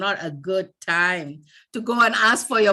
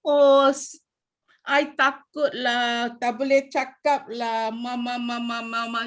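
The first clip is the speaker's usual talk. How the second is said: timid mumbler; 2 semitones higher than usual. Shown as a demonstration. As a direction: mumble helplessly, running the words into a drone. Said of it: "cannot, under your breath".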